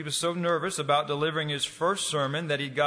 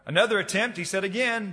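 usual speaking voice

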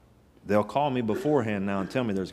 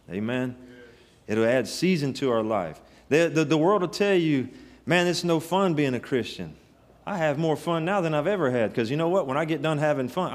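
full-force preaching; 3 semitones higher than usual. Acted. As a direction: preach it out, building fervor.

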